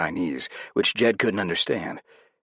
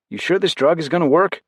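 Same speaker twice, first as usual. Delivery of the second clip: higher, louder worried ask; higher, louder doubtful question; anxious radio question; 12 semitones higher, 7 dB louder than usual. A narrator's reading. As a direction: press them with doubt. Worried question over comms.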